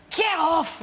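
loud, high Scottish yell of get off; broad Scottish shout. Retold or imitated. Shouting at [name] in a broad Scottish accent. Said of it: "in my broad Scottish accent".

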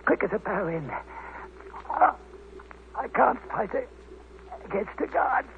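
weak dying voice. Acted. Dying man gasps out his story.

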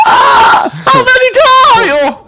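very high, loud, slow mock shriek; exasperated shriek. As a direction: throw your hands up in hammed-up despair. Reacting to being called queen.

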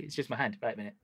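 quiet, hushed demo voice. Acted. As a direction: speak low while performing. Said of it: "hushed during squid demo".

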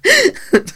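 sudden high loud laugh. Real, not acted. Voice actor cracks up after the take, real laugh. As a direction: burst out laughing.

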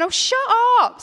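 high, loud oh shut up; disbelieving. Retold or imitated. Sister loudly refusing to believe her brother. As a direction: wave it off, still not believing. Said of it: "five minutes of me going".

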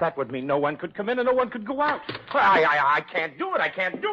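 rising, stammering panic; panicky protest. Acted. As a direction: plead in a flustered rush. Owner panics over quarantine.

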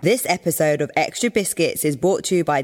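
smooth sponsor announcement; ad read. Acted. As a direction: read it like a radio ad.

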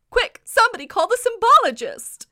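high, loud cry for help; mock alarm. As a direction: call out in a hammed-up emergency. Mock emergency call in summary.

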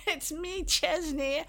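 high, funny-voiced reveal of a prank; Chesney. Prankster owning up to the receptionist, high nasally voice. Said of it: "he was kind of nasally".

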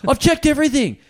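high, loud exasperated outburst; half-yelled frustration, hammed up; mock furious. Gym member yelling at the manager that he checked everything.

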